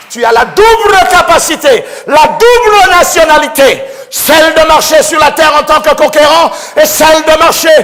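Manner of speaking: loud, high preacher's cry; fervent preaching. Acted. Preacher to congregation about faith, fervent shout. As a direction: proclaim faith to the crowd.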